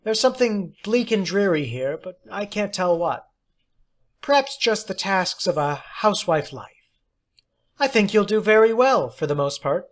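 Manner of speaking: lightly voiced fortune-telling teacher. A narrator's reading. Miss Ferenczi's voice, narrated.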